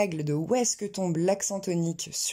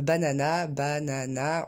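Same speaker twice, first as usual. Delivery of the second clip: BANANA with three equal full syllables, evenly timed; no syllable stressed; 3 semitones lower, slower than usual. Shown as a demonstration. Teacher showing learners the same rhythm throughout, unstressed French-style banana. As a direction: keep BANANA on one even rhythm, no syllable standing out. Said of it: "il n'y a aucune syllabe qui ressort".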